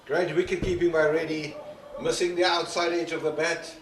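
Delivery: excited. Real, not acted. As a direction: burst out over a near miss. Near miss excites commentator.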